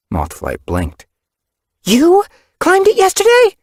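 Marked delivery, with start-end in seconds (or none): 1.8-3.6 high, loud shocked question; disbelieving gasp of a question. Acted. Mother shocked.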